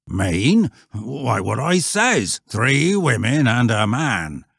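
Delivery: slow, loud rustic repetition; insistent old shepherd. Acted. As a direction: countryman spelling it out. Shepherd repeats himself to Stanley.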